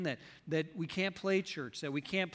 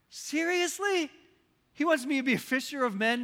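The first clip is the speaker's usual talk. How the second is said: hammed-up incredulous question; mock disbelief; 10 semitones higher, 7 dB louder than usual. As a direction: play up the disbelief at becoming a fisher of men. Mock incredulous reaction to calling.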